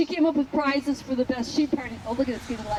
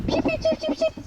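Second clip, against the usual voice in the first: high, squealing call to the sheep; excited sheep call; 3 dB louder than usual. Shown as a demonstration. Owner crashing the sheep party, high excited call. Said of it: "something like that".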